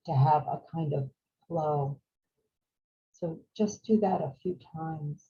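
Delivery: calm, unhurried meditation voice with long pauses; guided meditation. Real, not acted. Teacher guiding a group to follow the breath, calm meditation voice. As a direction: guide them gently through each breath, leaving space.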